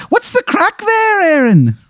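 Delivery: high, hammy Irish brogue; terrible stage-Irish leprechaun voice; bad Irish accent. Leprechaun ghost asking Aaron what's up, bad Irish accent. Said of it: "His, his accent is terrible".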